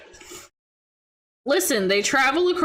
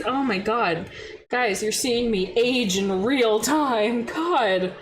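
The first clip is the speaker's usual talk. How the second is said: ordinary talk, nothing marked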